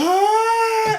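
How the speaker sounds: put-on high, open wail like a toddler; mock wailing sob. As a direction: burst into a high crying wail. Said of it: "like that open wailing sob".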